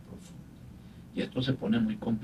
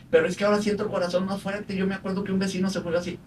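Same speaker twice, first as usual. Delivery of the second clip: higher and fast, mock anxious rambling; paciente angustiado; 7 semitones higher, faster than usual. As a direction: rush it like a scared patient. Voicing a worried anxious patient. (paciente nervioso imitado, agudo y rápido)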